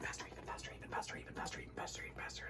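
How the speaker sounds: fast whisper fading away. Acted. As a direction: whisper it quickly, dying out.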